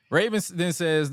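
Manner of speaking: normal talking voice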